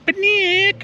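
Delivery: high, drawn-out mock holler; squeaky mock scream of a name; imitated holler. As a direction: imitate a very high-pitched call for attention. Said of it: "twenty-six twenty screaming at me".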